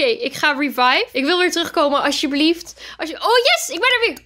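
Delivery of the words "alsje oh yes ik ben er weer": high, bright cheer of relief; joyful cheer. Real, not acted. Revived player cheers to her friends, high joy. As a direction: celebrate coming back to life.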